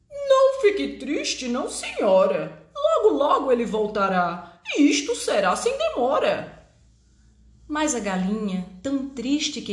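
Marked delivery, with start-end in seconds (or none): 0.1-6.7 cheerful duck voice. Acted. Duck consoles hen.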